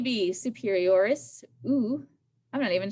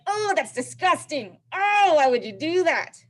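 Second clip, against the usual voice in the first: higher, disgusted recoil; 5 semitones higher, 6 dB louder than usual. Pretend. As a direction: sound grossed out. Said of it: "you're like".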